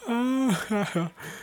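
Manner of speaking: high, light burst of laughter. Real, not acted. Laughing at the end.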